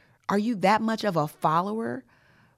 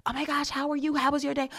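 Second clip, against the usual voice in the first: higher-pitched friendly greeting; chirpy small-talk greeter; 9 semitones higher than usual. Acted. Someone greeting her after a long workday, chirpy. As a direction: put on an eager how-are-you voice.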